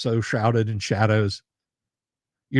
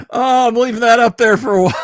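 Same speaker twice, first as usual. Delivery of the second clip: real laughing shout, much higher and louder; cracking up while announcing it; laughing and yelling at the table; 13 semitones higher, 5 dB louder than usual. Real, not acted. Laughing at his own roll.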